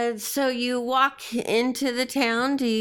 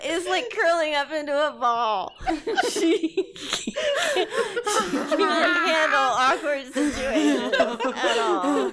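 high, helpless laughter through the words; real laughing; 7 semitones higher than usual. Real, not acted. Game master describing a player's awkward cringing, laughing. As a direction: talk while cracking up.

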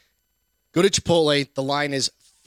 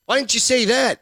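higher, exasperated question, mock frustration; exasperated retort; 8 semitones higher, 4 dB louder than usual. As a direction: demand it, fed up. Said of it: "I'm like".

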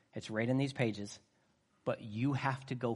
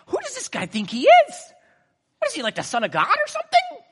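much higher, louder bewildered voice; confused disciples; 11 dB louder than usual. Retold or imitated. Preacher voicing confused disciples, high loud bewilderment. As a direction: play the baffled disciples, pitched up. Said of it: "And they're just like".

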